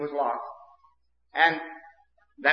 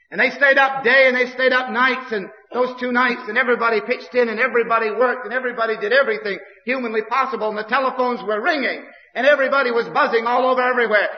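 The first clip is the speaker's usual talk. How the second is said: higher and louder, rushing the words; excited build; 6 semitones higher, 3 dB louder than usual. Real, not acted. Preacher telling the church of the frantic search, excited and fast. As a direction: pile it up breathlessly, faster and faster.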